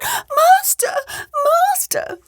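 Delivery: extremely high, thin little call; harp crying out. A narrator's reading. Magic harp alerting the ogre, very high cry. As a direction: pipe it out far above your own voice. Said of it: "the harp cried out".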